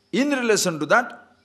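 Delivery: usual speaking voice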